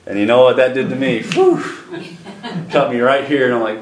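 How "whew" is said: sudden high-pitched "whew"; high whoosh. Retold or imitated. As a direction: imitate a swift cut with a breathy whoosh. Imitates his reaction in the story.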